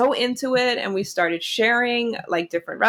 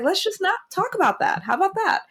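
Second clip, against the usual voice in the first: breezy dodge; 11 semitones higher than usual. Retold or imitated. Quoting herself dodging a political fight.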